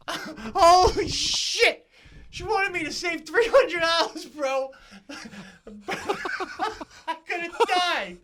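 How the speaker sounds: very high, loud, laugh-filled shock; cracking up at the absurdity; real laughing disbelief. Real, not acted. Shocked at near-death story.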